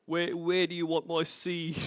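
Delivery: lightly voiced awkward Lorne on a date; a hint of his creepy date voice; mock Lorne voice. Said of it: "could you imagine this kind of stuff".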